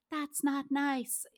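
soft, slow, toothless rebuke; meek mild rebuke. Retold or imitated. Melanie's character rebuking the boyfriend, soft slow imitation. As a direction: say it like it barely hurts. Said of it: "she was too soft in it".

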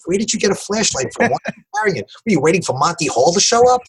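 high, fast, mock-disbelieving thought; incredulous inner voice. To himself about the flashlight people, high and incredulous. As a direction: voice his silent disbelief at strangers carrying flashlights.

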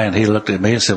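usual speaking voice

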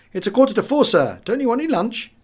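a hint of an eager young waiter calling down. Narrator voices the waiter.

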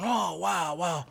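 mock amazement. Camera guy reacting to the scene, in mock amazement. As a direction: imitate a guy being amazed.

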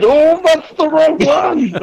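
very high, drawn-out falsetto wail; hammed-up high cry of pain; mock pained squeal. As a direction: squeal like you've been hit on the wrong leg. Acting out man whacked on leg.

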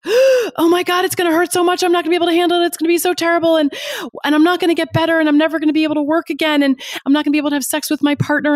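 catastrophic panicky voice. Acted. The inner pain voice catastrophizing before a procedure, high frantic panic. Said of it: "very loud and very catastrophic and very anxious".

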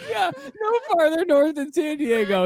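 high, slow, laughing through words. Real, not acted. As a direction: barely get it out for laughing. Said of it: "I'm fucking dying".

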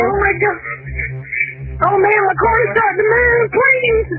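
frightened, pleading cries; real terror as the car moves; panicked. Real, not acted. Car sinking, caller panics.

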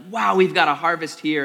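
thrilled and loud, amazed at the harvest; excited. Acted. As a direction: burst out in joyful surprise. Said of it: "they're like".

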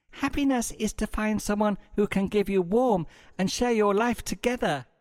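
put-on high, soft, cooing online girl; breathy girl voice. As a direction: read it like a dreamy bot profile. Bot bio read in voice.

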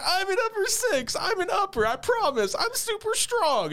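put-on very high kid-fit wail; squealed, whiny mock sobbing protest; mock crying. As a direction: imitate a child wailing in a tantrum. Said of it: "she starts crying and".

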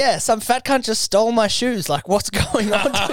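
high-pitched jokey complaint; mock outrage. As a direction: whine it like a wounded bystander. Continuing the mock bit.

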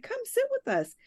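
a light high sing-song welcome; hint of a high friendly voice; friendly invite. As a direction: call her over sweetly. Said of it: "And she's like".

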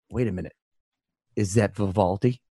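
low, soft, flat monotone; monotone Ollie voice. Retold or imitated. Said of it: "that guy coming up to you and being like".

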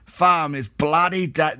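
slow West Country attempt gone Australian; accidental Australian accent. Reading fan post, West Country attempt turns Australian. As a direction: draw it out in mock Australian. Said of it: "no, that's Australian".

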